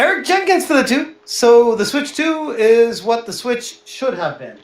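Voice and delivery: bold tech reviewer pitch. Pretend. Dad puts on a reviewer voice.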